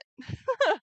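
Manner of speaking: shrill nervous laugh after a scare; high startled laugh. Real, not acted.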